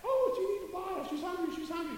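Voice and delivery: high-pitched mock fretting women; fussing women. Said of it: "the women were all together".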